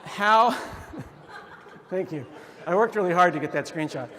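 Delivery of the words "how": real laugh. Real, not acted. Laughing at his screenshot.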